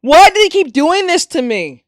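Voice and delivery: loud, high-pitched cry of protest; exasperated wail. Real, not acted. Streamer answers viewer's "Why?", wailing loudly. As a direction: wail it like a victim of pranks. Repeats protest after the scare.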